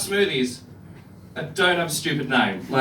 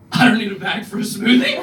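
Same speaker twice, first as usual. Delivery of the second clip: indignant; 2 semitones higher, 6 dB louder than usual. Retold or imitated. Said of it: "I was like".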